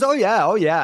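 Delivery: loud, high, emphatic mock assurance; loud, high-pitched imitated yeses; retold eager assurance. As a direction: act out someone overeagerly insisting they can.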